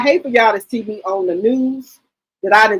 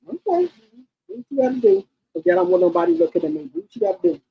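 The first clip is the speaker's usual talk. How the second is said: higher, quick teasing voice; mock breezy; 7 semitones higher, faster than usual. Mother mimicking her teasing reply to her children, higher. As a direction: shrug it off playfully.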